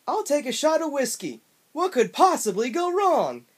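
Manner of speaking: high, loud cartoon bobcat voice; obnoxious Bubsy voice. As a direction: ham up an obnoxious cartoon cat. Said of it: "had the most obnoxious voice I've ever heard".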